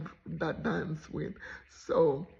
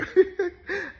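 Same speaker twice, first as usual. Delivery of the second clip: burst of laughter; 13 semitones higher, 9 dB louder than usual. Real, not acted. Laughs at memory.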